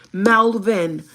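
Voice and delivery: loud, irritated correction of Malvin; imitated angry "MAL-ven", punched loud. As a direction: snap out Malvin, mimicking an offended man. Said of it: "así como muy enojado".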